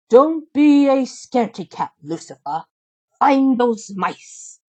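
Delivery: sly cartoon stepmother plotting chores; a hint of a sneering stepmother. Stepmother voiced.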